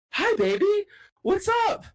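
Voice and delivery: put-on very high, sing-song baby voice; squeaky doggy-talk voice. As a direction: coo at the puppy like it's a baby. Talking sweetly to a stray dog.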